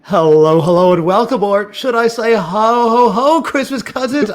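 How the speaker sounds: big booming show intro; announcer voice. Acted. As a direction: hype it up like a fight announcer. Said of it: "I'm announcer voice".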